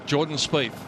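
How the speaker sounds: normal talking voice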